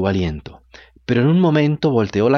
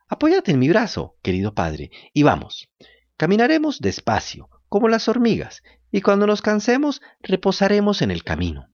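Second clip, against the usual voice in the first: put-on high puppet boy voice; 8 semitones higher than usual. A narrator's reading. Pinocchio to father.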